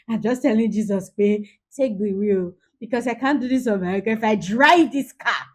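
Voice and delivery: loud, hammed-up plea for rescue; exasperated outburst. Hungry mother begs Jesus to take the wheel, loudly exasperated. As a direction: cry out like an overwhelmed parent at breaking point.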